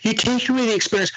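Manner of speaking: high, fast sing-song mockery; mocking purist. Mocking people who scorn numbing cream, high prissy. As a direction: parrot the tattoo snobs in a prissy voice. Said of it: "I don't care what anybody says".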